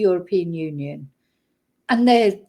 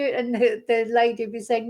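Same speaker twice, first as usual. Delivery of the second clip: normal talking voice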